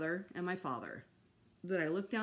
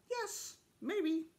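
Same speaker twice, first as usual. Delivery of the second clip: odd, higher bird voice; droll bird voice; 10 semitones higher than usual. A narrator's reading. Fudge's bird moaning about its health, odd voice. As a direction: croak out a sorry complaint.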